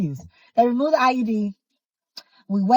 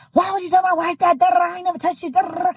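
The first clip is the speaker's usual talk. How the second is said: raised, higher mock yell; a retold husband's angry yell, higher; 7 semitones higher, faster than usual. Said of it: "he's yelling".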